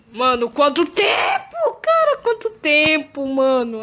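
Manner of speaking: slow, then a high squeal on cara; overjoyed. Real, not acted. Player sees friend Fox again, squealing with joy. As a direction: gush with happiness.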